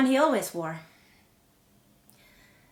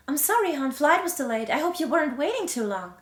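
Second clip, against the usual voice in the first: higher, lilting apology; raised-pitch husband's homecoming apology; voiced apologetic husband; 6 semitones higher, 4 dB louder than usual. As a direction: apologise brightly to your wife.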